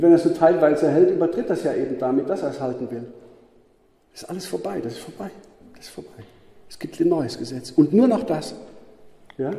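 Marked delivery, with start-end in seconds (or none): 4.1-6.2 das ist vorbei, each time quieter until whispered; fading to a whisper. Real, not acted. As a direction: let it die away to a breath. Preacher fades repeated phrase.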